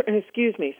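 real cough, drink down the wrong pipe; choking cough. Real, not acted. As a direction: cough then excuse yourself. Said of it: "something went down the wrong pipe".

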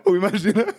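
normal talking voice

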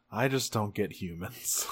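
low, soft, resigned mumble; imitated weary exasperation. Optimus after Alexis stomps off, weary exasperation. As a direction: give up on them quietly. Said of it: "has an exasperated sigh".

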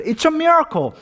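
high-pitched, overjoyed exclamation; acted, hammed-up astonishment; amazed delight. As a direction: proclaim it like a wonder. Joke pastor thrilled at match.

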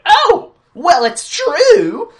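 high, loud, very slow exclamation; mock villain retort. Mocking the Mole King's line in a loud, high, drawn-out ham. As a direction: ham it up like a cartoon villain caught out. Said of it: "He's like".

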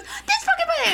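very high-pitched outraged imitation; retold indignant screech; mock yelling. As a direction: squawk it like a furious friend, laughing. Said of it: "she's yelling".

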